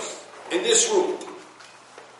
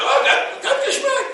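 much higher, shouted cry of delight. Retold or imitated. Rabbi recalls his first time, shouting with joy. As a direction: burst out in joyful amazement. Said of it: "I said".

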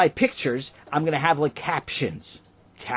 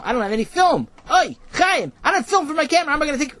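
put-on high excited kid; 13 semitones higher, 4 dB louder than usual. Boy panics about missing film.

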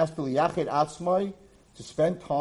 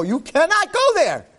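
scandalized protest; 12 semitones higher, 5 dB louder, slower than usual. Retold or imitated. Girls forbidding the rabbi.

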